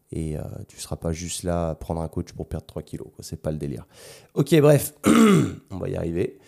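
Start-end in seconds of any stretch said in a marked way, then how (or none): 5.0-5.7 loud throat clear. Real, not acted. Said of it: "J'ai un peu une voix de chiotte".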